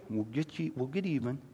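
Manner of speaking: nation's vengeful attitude, softly mocked; mock vengeful threat. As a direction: mutter a petty grudge. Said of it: "the attitude of our nation".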